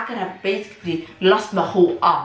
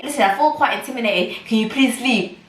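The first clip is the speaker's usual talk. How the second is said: retold firm demand; 6 semitones higher, 4 dB louder than usual. Retold demand that midwife leave.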